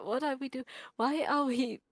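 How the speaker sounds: ordinary talk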